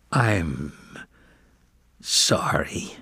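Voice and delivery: soft, near-whispered croak; humbled kitten croaking a forced apology. Acted. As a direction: force the apology out, barely voiced. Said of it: "croaked Varjak".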